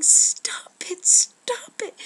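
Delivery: very high, very loud repeated scream; desperate scream. Acted. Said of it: "kept screaming".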